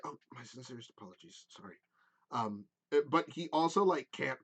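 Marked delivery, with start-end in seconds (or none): 0.0-1.8 soft, quick apology through tears; flustered and crying, very quiet; a hushed, rushed tearful sorry; teary satyr. Acted. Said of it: "he just like".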